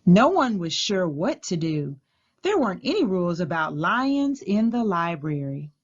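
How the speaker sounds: ordinary delivery, nothing marked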